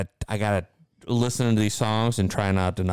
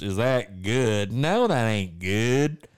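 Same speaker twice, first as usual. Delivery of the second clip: put-on very slow, drawn-out Southern American accent; southern drawl; 3 semitones higher, 4 dB louder, much slower than usual. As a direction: stretch it out like a lazy Southern guy. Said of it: "got this, like, southern drawl".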